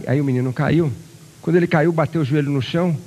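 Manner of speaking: usual speaking voice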